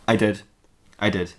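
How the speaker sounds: low, understated villain confession; Darth Maul. Acted. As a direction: own up in a low put-on character voice. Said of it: "said Darth Maul".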